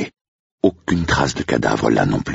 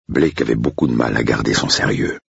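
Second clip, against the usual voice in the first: ordinary talk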